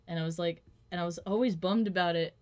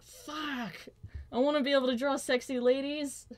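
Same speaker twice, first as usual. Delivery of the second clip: frustrated outburst; 8 semitones higher than usual. Retold or imitated. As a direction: wail it out in envy. Retold own frustrated outburst.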